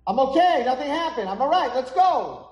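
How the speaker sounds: high, loud acted yell; indignant shouting boy. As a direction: shout up urgently as an impatient boy. Boy insists he is fine.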